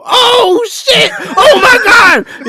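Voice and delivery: high, panicked shrieking, acted out; drawn-out high wail of mock terror; freaking out. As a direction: imitate a friend screaming as he flees. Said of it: "freaking out".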